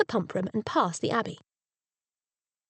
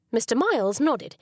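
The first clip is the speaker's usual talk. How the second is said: plain reading voice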